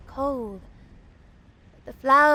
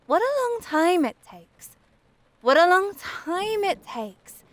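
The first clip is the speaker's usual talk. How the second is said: impatient longing; 6 semitones higher than usual. A narrator's reading. The flower longs for summer, impatient and yearning. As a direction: sigh out the long wait, pitched up.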